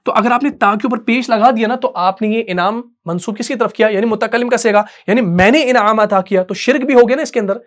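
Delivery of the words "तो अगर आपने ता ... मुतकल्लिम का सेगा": ordinary talk, nothing marked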